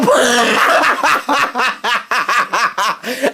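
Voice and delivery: shouting laugh; breaking up laughing. Real, not acted. Host breaks up during sign-off.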